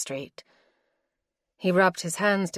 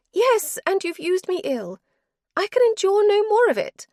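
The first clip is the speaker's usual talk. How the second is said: higher-voiced wife, cold and weary; wife's voice; 7 dB louder than usual. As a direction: she refuses him, tired and final. Wife's voice again.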